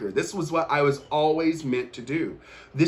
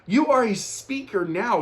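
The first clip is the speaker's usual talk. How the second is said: lifted affirmation; 8 semitones higher than usual. Retold or imitated. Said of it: "I was like".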